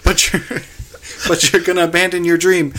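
high, loud hammed-up family scene; overacted soap-opera pleading; mock melodrama. Mock dad and son scene.

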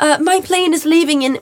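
asking a man to let her pass, high sweet plea; polite plea. Retold or imitated. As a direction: beg nicely with a lifted voice. Retold plea to man on plane.

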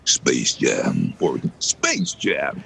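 slow, drawn-out promo title; announcer trying slow alternative reads; varied trailer reads. Shown as a demonstration. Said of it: "Or you can go".

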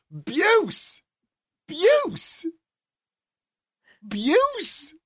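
high-pitched baffled yell, one word at a time; retold shouting. Man shouting at his cleaner over the kitchen counter, high yell. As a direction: re-enact yelling the word across a kitchen. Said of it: "I'm shouting at her over my kitchen counter".

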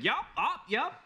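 high, loud chirpy yups; outdoorsy boy voice. Acted. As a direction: bark out eager yups. Boy character voicing outdoorsy grunt.

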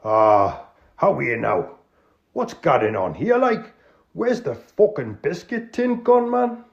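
high, loud Geordie outrage; Geordie accent. Acted. Tony demanding where the biscuits went, Geordie. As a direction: Geordie host fuming over the tin. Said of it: "an authentic, uh, Northerner".

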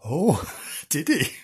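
usual speaking voice

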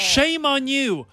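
loud mock scolding. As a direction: drag out the shame, booming. Repeated louder, slower.

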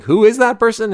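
high, baffled question, pitch jumps up; puzzled who-is-that. Retold or imitated. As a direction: squint at the screen, not recognizing. Viewer puzzled by unrecognizable star.

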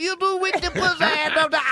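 high-pitched comedic impression of a famous comic; Bill Cosby impression. Riffing for a comic in the car, high-pitched impression. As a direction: riff in a high put-on voice. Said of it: "I do Bill Cosby sometimes".

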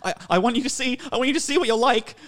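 high, loud, strained pleading; desperate pleading. Retold or imitated. Filming his drunk girlfriend, desperate high plea. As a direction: beg her to finally see herself. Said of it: "I'm watching the footage and I'm like".

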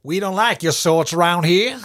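put-on American accent. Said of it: "Trespassers will be shot".

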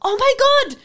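high, loud Flemish outburst; mock Flemish. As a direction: yell it in mock Flemish for the crowd. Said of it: "Everyone, one, two, three".

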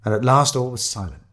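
usual speaking voice

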